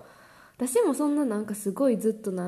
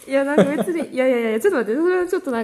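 high, loud objection; flustered protest; 6 semitones higher, 8 dB louder, much faster than usual. Real, not acted. Wife denying she'd scold husband for going out, loudly protesting. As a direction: cut in loudly to deny it, pitch raised.

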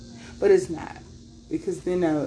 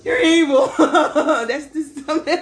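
high, loud words bursting into laughter; laughing hard; 7 semitones higher, 4 dB louder, slower than usual. Real, not acted. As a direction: crack up while saying it. Cracking up at own remark.

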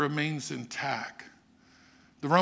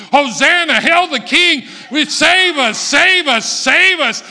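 loud, high, ringing cries of praise; crowd's shouted hosanna; 11 semitones higher, 14 dB louder than usual. Acted. Crowd hailing the King on the hill, loud high shouts.

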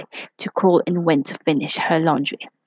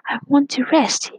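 high little voice pushing back; tired cookie arguing with the butterfly; 8 semitones higher than usual. A narrator's reading. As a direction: argue it in a high small voice. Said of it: "he argued".

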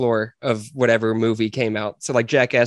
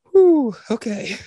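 relieved sigh; 10 semitones higher, slower than usual. Retold or imitated. Imagined relieved reaction.

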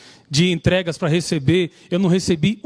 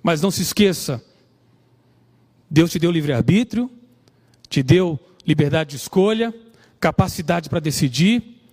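put-on raised-pitch sermon delivery to young listeners; preaching; 4 semitones higher than usual. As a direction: preach the lesson, pressing each point home. Preaching the lessons of Samson.

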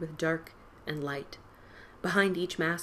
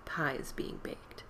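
whisper; 3 semitones lower, 6 dB quieter, slower than usual. A narrator's reading. Narrator whispers a line.

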